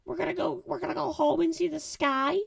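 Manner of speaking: high hopeful little raccoon voice; Rocket's voice. Retold or imitated. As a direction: voice young Rocket, eager and hopeful. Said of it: "And Rocket's like".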